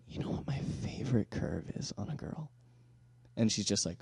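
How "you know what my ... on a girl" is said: hushed, breathy whispered repeat. Retold or imitated. Guy repeating his pickup line in a whisper to a girl. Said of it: "You whisper".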